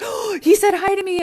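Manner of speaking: high, loud, starstruck gush; thrilled kid. Retold or imitated. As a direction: squeal it like a kid who just got noticed by the popular boy.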